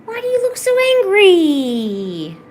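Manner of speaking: high, loud, drawn-out coo; slow high squeal sliding down. Real, not acted. Baby talk to grumpy pet.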